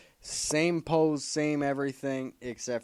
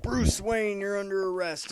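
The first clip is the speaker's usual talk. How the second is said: hammed-up cop; 10 semitones higher, 4 dB louder than usual. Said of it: "Jim Gordon's like".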